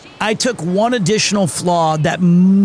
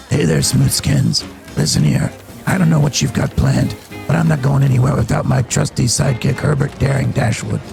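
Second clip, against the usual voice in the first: much lower, otherworldly ghoul voice; 6 semitones lower than usual. Pretend. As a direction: growl it low like an undead ghoul. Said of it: "You recently unlocked the ghoul voice".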